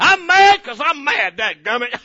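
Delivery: hammed-up loud, high angry outburst; mock tantrum, shouted; even madder. Preacher playing the grudge-holder getting worse, mock furious. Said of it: "that makes you even madder".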